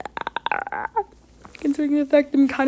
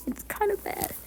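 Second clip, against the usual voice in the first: whisper; 9 semitones higher, 13 dB quieter than usual. Real, not acted. Muttered aside to himself.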